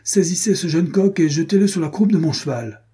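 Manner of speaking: enraged count ordering his servant, shouting; angry shout. Acted. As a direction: bark the command in a rage. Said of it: "cria-t-il".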